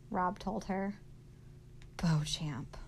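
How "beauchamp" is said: low, disgusted dismissal of a name. A narrator's reading. Willie Mae on hearing who owns the tiger, disgusted. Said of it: "said Willie Mae with disgust".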